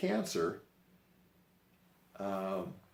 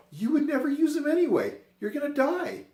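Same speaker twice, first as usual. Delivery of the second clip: high, loud mock yelling at mom; teasing yell at dying mother about receipts; mock shout; 12 dB louder than usual. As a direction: shout it up high, half joking. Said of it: "I'm like".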